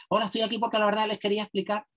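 fast and high, no pauses at all; mock nervous speaker gabbling through a talk; rushed nonstop talk. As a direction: rattle it off without taking a breath. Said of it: "que hace silencios cortos".

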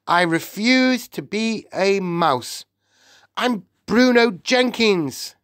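slow, high, emphatic tantrum; shouted refusal. A narrator's reading. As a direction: refuse indignantly, stretching each word. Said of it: "Bruno shouted, jumping up and down".

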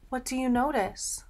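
hushed whisper of a secret. Acted. Teacher whispering a question to young listeners. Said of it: "whispered question to children".

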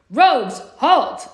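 loud, high amplified halt order; guard blaring at the intruders through a speaker; blaring command. A narrator's reading. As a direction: bark it through a loudspeaker. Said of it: "an amplified voice blared".

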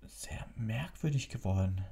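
a hint of an anxious boy; young Harry voice. A narrator's reading.

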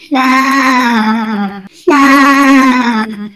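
normal talking voice

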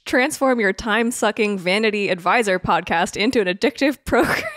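headline read-out. Pretend. Podcaster reads a favorite headline to co-hosts, loud headline read-out. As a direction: belt out the headline, breaking into laughter. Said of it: "I just wanna read my, my favorite headline".

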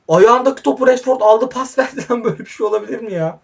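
high, loud cry of disbelief; can't believe what just happened; disbelieving outcry. Real, not acted. Disbelief at Rashford's pass.

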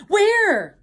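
high, loud startled yell; Tigger yelling. A narrator's reading. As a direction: yell the question out. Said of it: "yelled Tigger".

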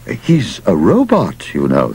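ordinary delivery

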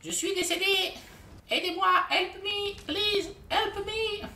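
high, whiny, stretched cry for help; mock dying plea. Downed player begging teammates for help, high and whiny.

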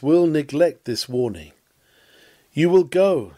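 usual speaking voice

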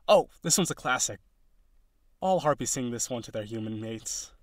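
ordinary talk

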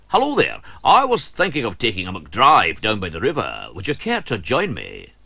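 hearty invitation; cheerful McTappets. Acted. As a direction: offer a ride brightly. McTappets voiced in story.

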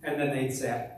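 normal talking voice

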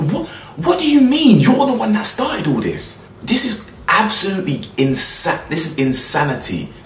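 furious can't-believe-it rant; outraged disbelief. Real, not acted.

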